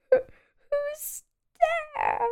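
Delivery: quiet sad squeak. A narrator's reading. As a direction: ask again, small and forlorn. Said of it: "A sad, squeaky sort of voice".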